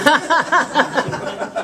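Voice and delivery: loud, high laugh bubbling over; burst of real laughter. Real, not acted. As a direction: laugh out loud before telling the joke. Laughing at own joke.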